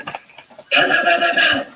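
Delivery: sharp high pow pow gunshot sounds; imitated gunshots. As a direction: mimic the gunfire, rapid and high. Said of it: "roll the tape".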